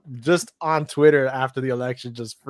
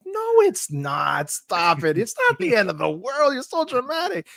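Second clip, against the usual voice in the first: mock exasperated reply; 9 semitones higher than usual. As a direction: scoff at a friend's drama, climbing higher. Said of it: "I'm like".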